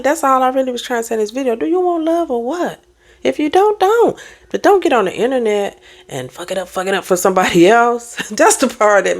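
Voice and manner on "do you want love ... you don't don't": exasperated challenge. Real, not acted. Repeated challenge, rising pitch.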